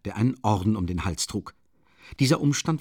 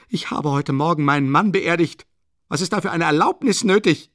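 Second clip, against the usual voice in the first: loud, raised-pitch protest; indignant outburst; 9 semitones higher, 8 dB louder than usual. A narrator's reading. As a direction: protest in outrage at the demand. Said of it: "schrie Katharina Iwanowna empört". (empört und laut protestierend)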